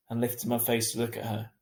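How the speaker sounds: ordinary delivery, nothing marked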